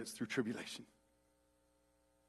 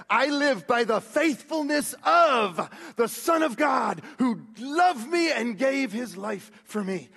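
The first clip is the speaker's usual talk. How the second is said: higher, louder, slow shouted preaching; preaching at full force; 7 semitones higher, 5 dB louder than usual. Acted. Preacher's climax urging the church to keep faith, shouting. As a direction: proclaim it, slow and ringing.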